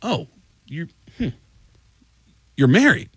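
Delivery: halting "Oh... you're... hmm", then "married" lifted; puzzled, with long gaps before "you're married"; hesitant pauses. Retold or imitated. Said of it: "the guy just paused and went".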